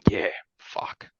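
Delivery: hushed, choked-up near whisper; words fading to a whisper, overcome; real overwhelmed emotion. Real, not acted. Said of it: "I'm just going through the motions".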